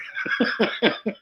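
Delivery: high, airy chuckling burst; real laugh. Real, not acted. Laughing after saying worked with nature, real laugh. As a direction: laugh at your own modest answer.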